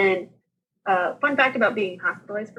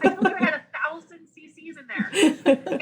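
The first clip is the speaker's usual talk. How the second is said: high-pitched, laughing vindication; retold comeback, pitched up and amused; told-you-so; 9 semitones higher than usual. Patient answering nurses about the catheter bag, vindicated.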